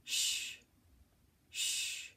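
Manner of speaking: "Sh" as a long voiceless hush, no vowel; letter sound. Shown as a demonstration. Teacher showing S and H together, long hushing sound. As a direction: hold out each "Sh" on breath alone. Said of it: "What sound do those make together?".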